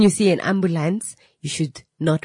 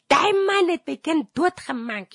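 hysterical crying; 11 semitones higher than usual. Retold or imitated. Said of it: "she's just hysterical".

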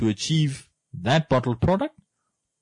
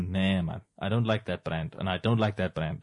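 low, soft mock dismissive drinker; 7 semitones lower, 7 dB quieter than usual. Friend mimicking a picky Namibian beer drinker, low and dismissive.

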